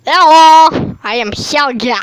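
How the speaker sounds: loud put-on character voice; Helga impression. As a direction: boom out a silly Helga. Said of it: "my Helga impression".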